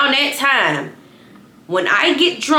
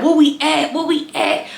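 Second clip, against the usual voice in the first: put-on pestering drunk question; 6 semitones higher than usual. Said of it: "I keep asking Ryder".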